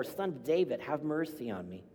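ordinary talk

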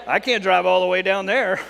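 loud, hammed-up complaint; mock protest. Preacher mocks himself refusing a local drive, mock protest. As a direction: balk at the trip with comic exaggeration. Mocks own reluctance to travel.

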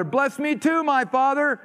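high, pleading cry of the son, lightly voiced; pleading Esau. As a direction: beg for the blessing, a hint of a desperate son. Esau begs his father.

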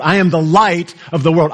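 "light" punched out; all the weight on "light", then ease off; stress on LIGHT. Shown as a demonstration. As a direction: hit the word "light". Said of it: "he could have emphasized anything".

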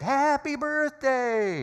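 drawn-out, high, loud shout; surprise shout. Retold or imitated. The crowd surprises Agnes, a long high shout. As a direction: let it burst out long and high. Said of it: "and everybody shouted".

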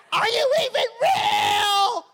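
high, slow, shouted challenge; mock shout at God. Pastor shouting a mock prayer at God, slow and high. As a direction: yell up at heaven, mock exasperated. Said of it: "have you ever talked to God like that".

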